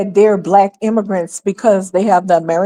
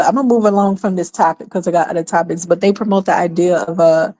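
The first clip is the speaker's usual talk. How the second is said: ordinary delivery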